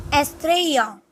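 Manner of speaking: ordinary talk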